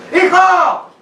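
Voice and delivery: high shouted call to a son. Shown as a demonstration. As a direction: yell out for your boy. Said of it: "Cuando usted alza la voz".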